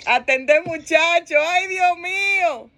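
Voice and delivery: high, stretched-out mock cry; mock wailing. Overwhelmed wife crying to God over the children, mock wailing. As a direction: wail it out in comic despair. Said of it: "Entonces comienza otra lloradera".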